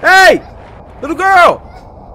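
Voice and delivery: loud, high holler; calling out. Pretend. Player calling to the little girl in the woods, hollering. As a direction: holler to a child somewhere far away.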